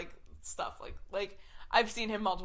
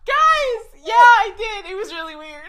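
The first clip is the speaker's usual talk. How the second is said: very high, loud squeal through laughter; hammed-up shriek, breaking into laughs; over-the-top confession; 13 semitones higher, 17 dB louder than usual. Confessing to the shocked castmates, squealed dramatically.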